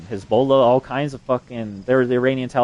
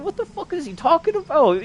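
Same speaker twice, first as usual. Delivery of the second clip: high-pitched, retold disbelief; incredulous outburst. Reacting to a friend's wild laser story, high-pitched incredulous disbelief. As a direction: replay your baffled reaction, voice shooting up.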